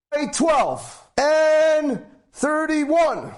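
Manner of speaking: high, drawn-out bingo call; slow, high, stretched-out bingo caller. Retold or imitated. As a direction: sing out the number like a bingo caller. Bingo caller announcing numbers.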